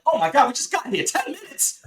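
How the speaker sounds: alarmed, caught off guard; sudden panic, rising high; panicked surprise. Retold or imitated. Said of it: "Oh, like".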